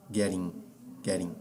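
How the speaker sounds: "Getting" said ge-ri, double T as a quick tapped R. Shown as a demonstration. Teacher showing "Getting" with the T's sounding like R. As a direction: tap the TT in "Getting" like a Portuguese R. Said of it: "ele fica assim, é com som de R".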